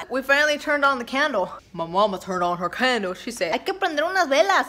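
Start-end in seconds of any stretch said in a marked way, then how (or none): 3.5-4.7 higher, exaggerated Spanish-accented mom voice; mock mom accent. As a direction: ham up a Mexican mom's accent. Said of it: "I'm just kidding, she doesn't sound like that".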